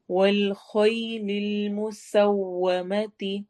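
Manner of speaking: very slow chanted tajweed recitation; teacher reciting verse for students; Quran recitation. Shown as a demonstration. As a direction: intone each syllable slowly.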